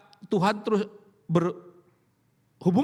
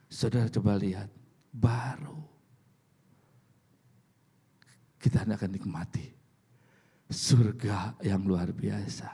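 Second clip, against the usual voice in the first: hushed awe; 8 semitones lower than usual. Acted. Hushed build to heaven.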